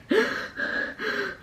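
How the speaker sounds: sharp, breathy gasps; imitated frantic breathing. Daughter breathing frantically in her sleep, imitated gasping. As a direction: gasp like a child struggling in sleep. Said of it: "like, frantically breathing".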